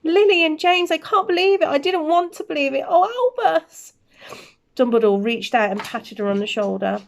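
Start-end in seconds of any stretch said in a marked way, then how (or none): 0.0-3.9 higher, crying out in grief; grief-stricken gasp. Acted. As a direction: break down over lost friends. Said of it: "Professor McGonagall gasped".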